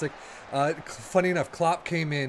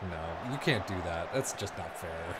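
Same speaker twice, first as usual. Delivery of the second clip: a hint of a stern official voice; lightly voiced governing body saying no; mock German FA official; 10 semitones lower, 8 dB quieter than usual. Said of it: "German F A should be like".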